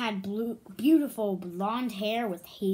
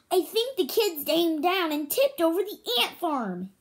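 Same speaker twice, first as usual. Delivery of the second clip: a bit higher, small ant explaining; lightly voiced little ant; tiny ant voice; 7 semitones higher, 4 dB louder than usual. A narrator's reading. Vincent the ant speaks.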